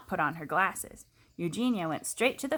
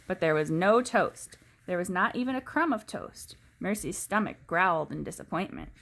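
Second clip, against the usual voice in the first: ordinary delivery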